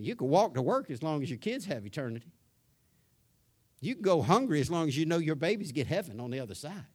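loud, rising preacher build; preaching at full force. Real, not acted. Preacher reminding parents what matters most, building loud preaching.

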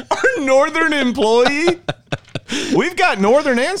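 high, slow incredulous question; mock surprise. Real, not acted. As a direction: drag it out in disbelief.

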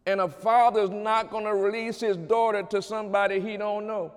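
preaching at full force. Acted. Preacher assuring the congregation of God's care, fervent preaching. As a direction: lift it up and drive it home.

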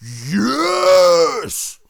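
a high, loud burst of joy; mock cheer. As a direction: shout yes like you just won. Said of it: "that part of me that's just like".

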